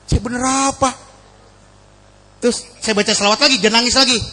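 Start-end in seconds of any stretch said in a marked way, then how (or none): 0.1-1.1 sceptical Betawi. Retold or imitated. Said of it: "orang Betawi kadang-kadang".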